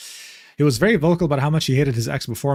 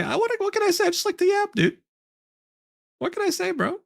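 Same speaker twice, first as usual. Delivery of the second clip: very high, softened put-on squeak; silly high voice; 4 dB quieter than usual. Streamer jokes about liking the app, silly high voice. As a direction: pitch it way up, playfully.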